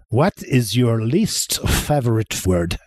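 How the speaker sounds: slow, put-on French accent; playful mock-French delivery. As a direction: pose it slowly like a French quizmaster. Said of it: "gonna do this in a French accent".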